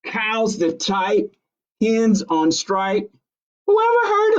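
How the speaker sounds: a farmer who can't believe it, indignant; exasperated farmer. Acted. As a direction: sputter like a flustered farmer. Farmer's outraged speech.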